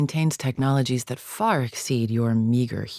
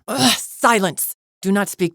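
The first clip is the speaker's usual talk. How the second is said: exasperated outburst; 7 semitones higher, 5 dB louder than usual. Acted. Champion silencing a wastrel, exasperated outburst. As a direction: groan in disgust, then order it loudly.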